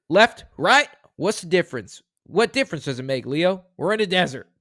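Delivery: high, loud at the start, then settling; dramatic movie read. Shown as a demonstration. Host reading a movie quote aloud, with a loud high opening. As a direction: punch out the opening like an action hero. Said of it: "Let me try that again".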